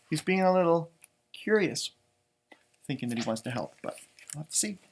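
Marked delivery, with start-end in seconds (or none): none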